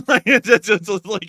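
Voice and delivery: high, loud laughter while talking; laughing. Real, not acted. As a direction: burst out laughing mid-sentence. Laughing at own impression.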